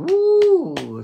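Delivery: high, loud whoop of joy; excited cheer. Real, not acted. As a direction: let out a big celebratory whoop. Cheering after Korean greeting.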